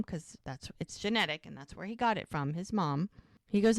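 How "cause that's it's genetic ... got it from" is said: usual speaking voice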